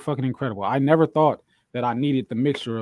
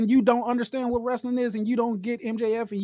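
excited rant; 8 semitones higher than usual. Real, not acted. Excited rant, high pitch.

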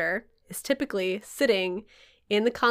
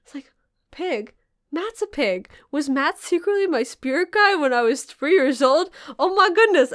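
excited realisation; 5 semitones higher, 3 dB louder than usual. Retold or imitated. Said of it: "'cause I'm like".

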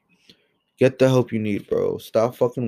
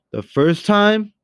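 The first time much higher, louder and slow; emphatic, drawn out; 10 semitones higher, 5 dB louder, slower than usual. Real, not acted. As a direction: lean hard on first time. Repeats phrase, first emphatic.

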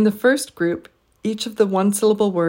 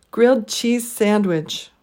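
Grilled, cheese, sand stressed slowly, wich unstressed; slow Grilled cheese sandwich with a weak wich; deliberate three-beat Grilled cheese sandwich; three stresses, one weak; slower than usual. Shown as a demonstration. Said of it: "three stressed syllables and one unstressed syllable".

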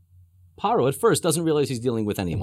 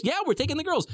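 high, breezy mock reply; breezy affirmation; 13 semitones higher than usual. Moshe answering doubtful Pharaoh, breezy. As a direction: answer in a high, carefree lilt.

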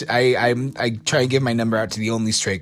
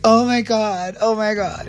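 high, gushing drag queen; 9 semitones higher than usual. Retold or imitated. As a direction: gush over the proposal.

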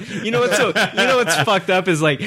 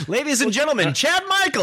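announcer voice; 10 semitones higher than usual. Acted. Mock announcer introducing Chad.

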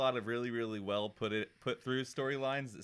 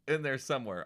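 ordinary delivery

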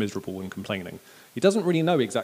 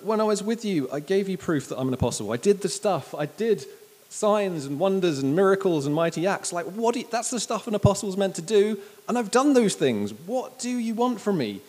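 raised pitch, exasperated self-defence; frustrated; 8 semitones higher, 3 dB louder than usual. Acted. Apostle defending his record to Corinth, frustrated. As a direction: plead your case in frustration. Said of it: "You can sense his just frustration at that".

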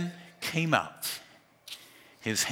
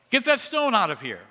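higher and louder, a sharp command; angry order; 10 semitones higher, 5 dB louder than usual. Shown as a demonstration. Jesus ordering the crowd at the tomb, angry. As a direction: demand it, impatient. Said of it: "He's angry".

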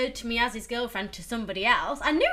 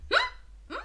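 very high startled hums; mock startled double take; startled meerkat. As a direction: pop up like a meerkat. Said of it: "meerkat over the head".

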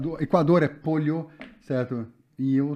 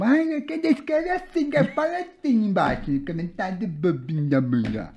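agudo e arrastado, palavras emboladas; voz cantarolada incompreensível; 5 semitones higher than usual. Pretend. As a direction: embole tudo numa voz fina e lenta. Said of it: "vou falar assim porque ninguém entende". (voz aguda e embolada, de brincadeira)

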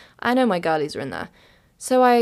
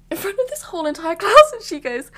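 high, giggly squeal; laughing; 12 semitones higher, 6 dB louder than usual. Real, not acted. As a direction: laugh through the words. Laughing at embarrassing moment.

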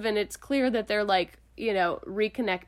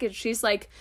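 ordinary talk

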